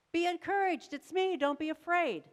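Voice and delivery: high, loud call across the water. Acted. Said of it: "A voice calls out".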